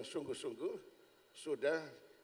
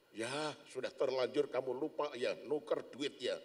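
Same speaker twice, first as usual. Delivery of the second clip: normal talking voice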